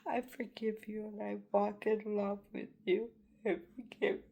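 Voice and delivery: holding back tears while forgiving; emotional. Real, not acted. Forgiving those who blame her, voice choked. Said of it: "I don't mean to get emotional".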